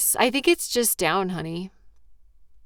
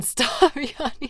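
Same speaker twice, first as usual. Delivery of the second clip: laughing through it; 6 semitones higher, slower than usual. Real, not acted.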